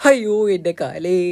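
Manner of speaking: slow, stretched-out moan; crying in pain. Acted. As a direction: drag out each word in a hammed-up wail. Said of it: "കുറച്ചൊക്കെ മാറിയിട്ട് കരഞ്ഞോ". (വേദനയിൽ നീട്ടിയ കരച്ചിൽ)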